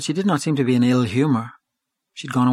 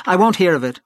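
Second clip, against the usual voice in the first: raised, higher-pitched protest; louder, emphatic exclamation in narration; crying out; 6 semitones higher, 6 dB louder than usual. A narrator's reading. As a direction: cry out a brisk refusal. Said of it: "she cried".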